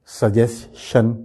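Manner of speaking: "سجیشن" slowly as su-jes... shun; common mispronunciation. Shown as a demonstration. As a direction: split "سجیشن", ending in SH. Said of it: "جس کو ہم". ("سجیشن" کے آخر میں "شن")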